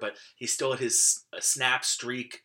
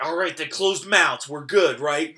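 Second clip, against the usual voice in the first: put-on excited kid; 4 semitones higher, 9 dB louder than usual. Kid hearing school closings.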